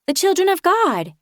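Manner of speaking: put-on high, eerie small boy; a child reciting a creed. Magni answering narrator, high eerie child voice.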